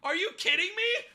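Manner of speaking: shocked disbelief. Retold or imitated. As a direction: react as if you can't believe it. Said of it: "I'm like".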